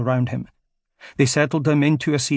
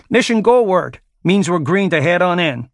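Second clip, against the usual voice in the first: loud call over the engines; raised, higher shout; 5 semitones higher, 6 dB louder than usual. Acted. Seal shouts over helicopter.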